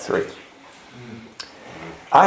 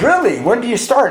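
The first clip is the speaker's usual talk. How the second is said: surprised brother; 10 semitones higher than usual. Retold or imitated. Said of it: "And he goes".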